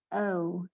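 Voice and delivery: "O" as a gliding two-part vowel; diphthong O. Shown as a demonstration. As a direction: say "O" like the name of the letter. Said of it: "we have a diphthong".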